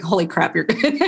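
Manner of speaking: amazed, laughing through it; laughing amazement. Retold or imitated. Crying friend after a first reading, laughing in amazement. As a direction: gasp it out, half laughing. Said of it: "she's like".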